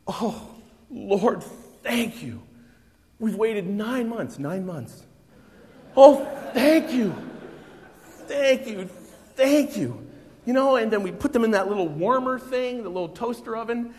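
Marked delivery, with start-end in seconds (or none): 0.1-10.1 loud, rising cries of thank you over the newborns; joyful father thanking God at the birth; overwhelmed thanks. Retold or imitated. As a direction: pour out gratitude like a new father in tears. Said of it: "and I'm just".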